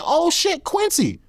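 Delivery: put-on high, startled girl's voice; star-struck girl. Girl spots Quincy at the hotel door, in a high, startled voice. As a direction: gasp out the name like spotting a celebrity.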